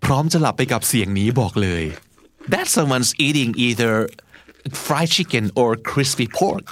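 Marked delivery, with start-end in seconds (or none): 2.5-6.7 slow repeat. Shown as a demonstration. Model sentence repeated.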